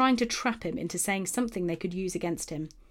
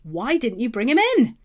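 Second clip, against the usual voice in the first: demanding; 4 semitones higher, 6 dB louder than usual. A narrator's reading. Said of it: "the leading priests and Pharisees demanded".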